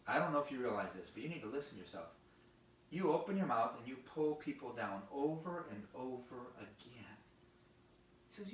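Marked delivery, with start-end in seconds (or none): 0.1-7.2 low, quieter fatherly talking-to; a hint of a serious dad; earnest father. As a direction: lower the voice and counsel a child seriously. Father gently corrects his son.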